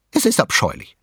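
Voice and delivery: high, disdainful wife; Mrs Willoughby's voice. A narrator's reading. Mother complains to husband, high and disdainful. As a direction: sniff at it from above. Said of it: "sagte sie".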